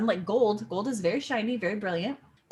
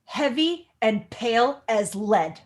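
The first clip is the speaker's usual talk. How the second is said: each insult flung louder, with a rising lilt; Juliet's insults; 3 semitones higher, 7 dB louder, slower than usual. Acted. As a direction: spit out the insults one by one. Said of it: "drives it home with a few more insults".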